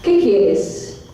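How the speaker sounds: low, hushed-pace narration with long pauses; guided visualization. Acted.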